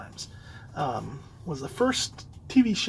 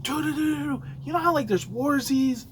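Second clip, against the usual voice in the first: higher, eager dude-dude-dude; excited friend; 7 semitones higher than usual. Retold or imitated. Friend rushing to share his discovery, excited. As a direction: blurt it out like big news.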